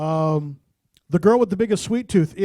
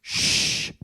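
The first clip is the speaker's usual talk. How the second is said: hushing. Real, not acted. Hushing the room before singing.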